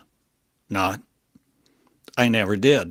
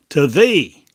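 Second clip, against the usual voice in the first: proclaimed; 5 semitones higher, 7 dB louder than usual. Acted. Climactic proclamation.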